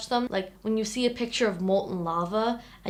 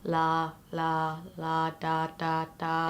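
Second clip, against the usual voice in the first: lightly voiced robot, lower and even; a hint of a monotone machine; droning robot singsong; computer voice; 4 semitones lower, 6 dB quieter, much slower than usual. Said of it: "I am talking in my computer voice".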